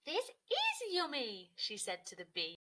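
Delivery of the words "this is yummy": higher, louder, slow and happy; Cody happily telling the bee; delighted. Acted. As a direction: relish every word.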